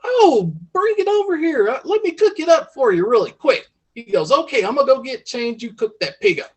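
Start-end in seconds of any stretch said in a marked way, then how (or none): 0.0-3.7 high-pitched, playful country-wife voice; eager farm wife. Acted. Wife in story offers to cook. 4.3-6.5 lightly voiced country husband, pitched up; a hint of a folksy farmer agreeing. Husband in story answers wife.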